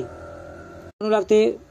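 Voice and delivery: usual speaking voice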